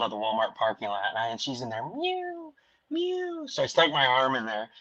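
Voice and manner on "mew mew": high, soft kitten mew; small high mewing; imitated kitten mew.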